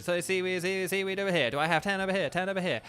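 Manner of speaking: rattling repeated calls for bids; mock auction-house spiel; auctioneer patter. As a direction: work the room like an auctioneer. Plays auctioneer.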